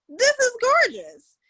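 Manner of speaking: amazed. Retold or imitated. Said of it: "And I was like".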